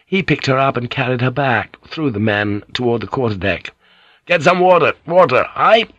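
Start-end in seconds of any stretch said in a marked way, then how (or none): 4.2-5.9 loud, high-pitched call for help; raised, pressing cries; urgent shout. A narrator's reading. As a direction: shout orders to the crew in an emergency. Calling for water in emergency.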